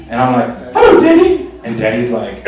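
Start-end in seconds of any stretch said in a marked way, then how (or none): 0.7-1.5 very high, loud comic squeak; hammed-up squeaky schoolboy; squeaky greeting. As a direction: greet him in a tiny falsetto. Acts timid younger self greeting Daddy.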